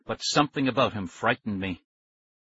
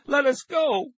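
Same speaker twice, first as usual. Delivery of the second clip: very high, strained yell; shout; 3 dB louder, slower than usual. A narrator's reading. Nicky squirming in the man's grip, shouting. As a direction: cry out high to be released. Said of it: "Nicky shouted again".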